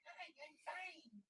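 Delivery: very high, soft, slow squeak; mock meek little voice; tiny little guy. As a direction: shrink into a timid little man. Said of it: "So I'm little guy".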